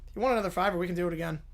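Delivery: ordinary talk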